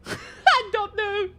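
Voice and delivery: high, slow laughing words; helpless laugh while speaking. Real, not acted. Laughs at own failed accent.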